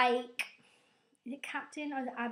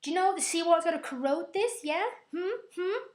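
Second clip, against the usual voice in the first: higher, louder, fast nagging; annoyed Scotty; 6 semitones higher, 4 dB louder than usual. Retold or imitated. Scotty nagging Kirk about seawater, annoyed. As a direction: nag him like a grumpy engineer. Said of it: "Scotty's getting all annoyed".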